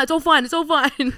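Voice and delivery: high, loud, repeated brush-off; dodging partner. Retold or imitated. Imitating partner insisting it's all fine, high and loud. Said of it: "and he's like".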